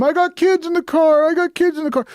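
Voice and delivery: very high mocking panicked yell; mock scream. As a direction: shriek it like a parent faking panic. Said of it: "they can scream".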